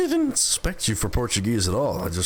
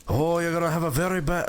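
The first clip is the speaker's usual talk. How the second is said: higher-pitched Spanish accent going astray; botched Spanish accent; 6 semitones higher than usual. Shown as a demonstration. Streamer trying an English sentence in accent, botched Spanish accent. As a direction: try a Spanish accent and miss. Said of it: "no, wrong accent".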